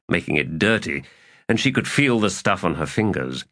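ordinary delivery, nothing marked

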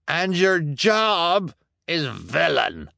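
slow, high gloating; triumphant. Acted. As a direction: gloat over the accused. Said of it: "called out triumphantly".

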